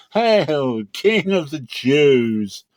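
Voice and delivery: high, loud, very slow mock salute; mocking hail. A narrator's reading. Soldiers kneeling to jeer at Jesus, high, loud and slow. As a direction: drag it out in scorn like jeering soldiers. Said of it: "mocked him".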